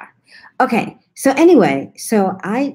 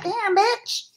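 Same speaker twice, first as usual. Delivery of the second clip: impressed dismay; 4 dB quieter than usual. Retold or imitated. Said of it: "And I was like".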